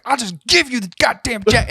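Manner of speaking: high-pitched comic exasperation; mock frustrated yell; fed-up outburst. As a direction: imitate a desperate seller giving up. Seller's fed-up outburst, retold again.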